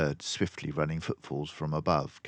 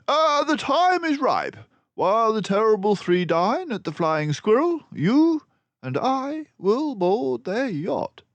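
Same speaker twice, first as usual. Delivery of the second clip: loud, not softly; 8 dB louder than usual. A narrator's reading. Basil announcing the plan to Dawson, loudly. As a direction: speak up boldly, not caring who hears. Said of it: "none too softly".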